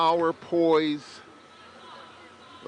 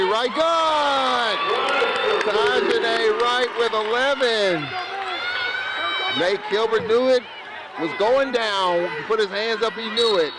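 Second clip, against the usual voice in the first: real thrill of a made shot; excited call; 6 semitones higher than usual. Real, not acted. Three-pointer drops, commentator erupts.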